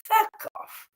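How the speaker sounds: a high, scornful mock of fake streamers; mocking imitation. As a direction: sneer it like the people she mocks. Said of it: "doing a live and going".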